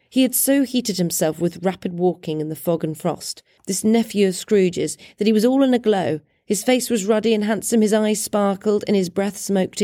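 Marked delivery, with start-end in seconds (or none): none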